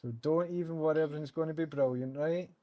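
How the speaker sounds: usual speaking voice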